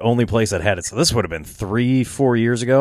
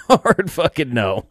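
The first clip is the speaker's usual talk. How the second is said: slow, drawn-out no through a laugh; laugh, then stretch out the refusal; 4 semitones higher, slower than usual. Retold or imitated. Storyteller repeating his buddy's refusal of fruity beer, amused.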